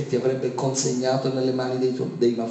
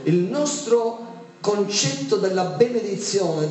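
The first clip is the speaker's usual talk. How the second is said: normal talking voice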